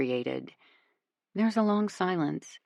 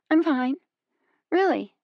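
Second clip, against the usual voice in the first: forced reassurance; 10 semitones higher, 5 dB louder than usual. A narrator's reading. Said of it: "trying to convince herself".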